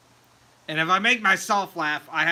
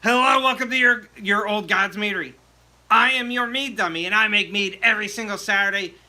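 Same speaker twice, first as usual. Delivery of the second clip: commercial read; 3 semitones higher than usual. Acted. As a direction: sell it like a channel ad. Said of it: "go through my commercials".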